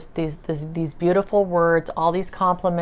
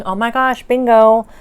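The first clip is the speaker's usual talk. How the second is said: higher and louder, bingo shouted out; excited burst; 5 semitones higher, 9 dB louder than usual. Retold or imitated. Retelling her joy at the spirits' news, loud excited shout. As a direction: burst out with bingo like a jackpot win. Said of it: "I got so excited".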